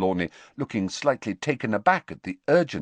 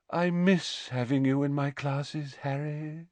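higher, wistful, softening at the end; soulful; 7 semitones higher than usual. A narrator's reading. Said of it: "she said soulfully".

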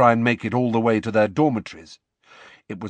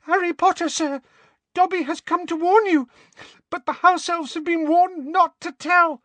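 very high, squeaky elf voice; a frightened squeaky elf pleading; squeaky house elf. Acted. Dobby voiced, trembling.

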